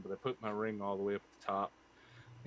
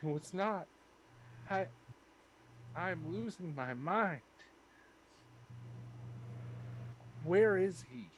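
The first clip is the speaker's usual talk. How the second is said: distraught; 9 semitones higher than usual. Acted. Zontan breaking down, losing mind.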